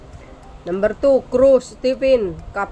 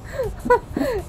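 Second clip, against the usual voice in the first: high giggle; 14 semitones higher, 5 dB quieter than usual. Real, not acted. Laughing at angry senator.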